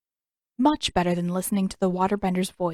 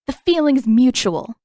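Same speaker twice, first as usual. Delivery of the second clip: heated, louder retort; hotly; 5 semitones higher, 8 dB louder than usual. Acted. Katara hotly answering Zuko through the bars, heated. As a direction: fire it straight back at him. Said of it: "she answered hotly".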